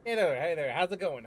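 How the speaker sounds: ordinary delivery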